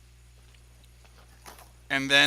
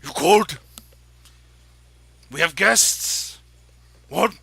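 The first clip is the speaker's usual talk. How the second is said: a lightly voiced orc wife, louder; orc woman; 2 semitones higher, 7 dB louder, slower than usual. Orc wife calling out to her husband, loud. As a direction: boom it out like a big orc housewife. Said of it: "and she goes".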